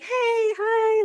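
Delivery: high, sing-out imitation of a kid saying hello; high, drawn-out friendly hello; friendly child greeting. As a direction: greet like a child running up to a neighbor. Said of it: "they'll run up and be like".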